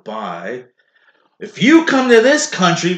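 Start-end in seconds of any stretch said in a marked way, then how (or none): 1.4-2.9 imitating an angry nativist, high and loud; raised, pitched-up mock rant; mock anger. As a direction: burst out in put-on indignation. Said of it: "the anger that people have".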